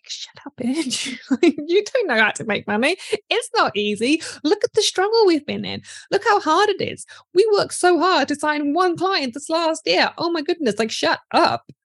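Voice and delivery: high, whiny mocking mean-girl voice; mean gremlin. Coach voices the mindset gremlins mocking a new belief. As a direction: play the nasty inner critic sneering at you. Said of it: "They're gonna be like".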